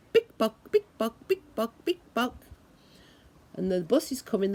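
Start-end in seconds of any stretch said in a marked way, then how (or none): 0.1-2.3 quick clacking big buck; high heels clicking. Retold or imitated. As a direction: tap it out like heels walking. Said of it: "the lady's high heels go".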